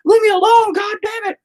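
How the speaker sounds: very high, loud mock yell at his son. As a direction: shriek it like a dad losing it. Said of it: "I just yell at him all the time".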